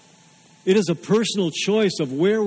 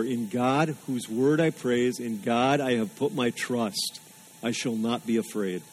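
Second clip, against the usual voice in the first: softer, lower reading of the psalm; scripture reading; 4 semitones lower, 5 dB quieter than usual. Acted. Pastor reading the psalm to the congregation, soft reading voice. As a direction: let the verse settle, read softly. Reads verse four aloud.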